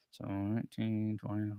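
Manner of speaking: softly tallying to oneself; quiet thinking-aloud count; counting under breath. Real, not acted. Counting up the years to himself.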